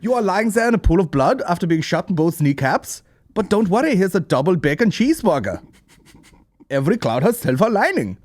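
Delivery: put-on high, loud German-accented parody; hammed-up German consoling a victim; mock German accent. Mocking German's offer as a sketch.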